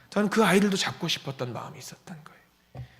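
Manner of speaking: soft whispered sincere admission; whispered confession. Real, not acted. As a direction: let it out under your breath. Confessing heartfelt wish.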